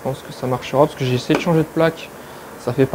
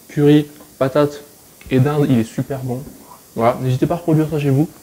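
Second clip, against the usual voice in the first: normal talking voice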